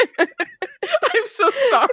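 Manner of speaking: high, slow apology through laughter; cracking up while apologizing; helpless laughter. Real, not acted. Cracking up after own impression.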